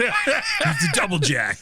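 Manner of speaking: dramatic announcer. Pretend. Introducing the masked hero, slow mock trailer voice.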